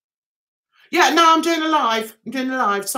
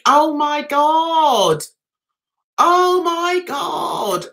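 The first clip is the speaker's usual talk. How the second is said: drawn-out disbelief; 4 semitones higher, much slower than usual. Real, not acted. Reacting to hard choice.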